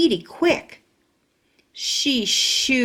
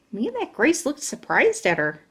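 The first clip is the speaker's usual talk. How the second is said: plain reading voice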